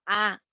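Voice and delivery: "A" as an ordinary open Spanish ah; plain, fully open "A" vowel; normal A. Shown as a demonstration. Teacher contrasting it with the schwa, normal open "A". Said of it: "no es como una A normal".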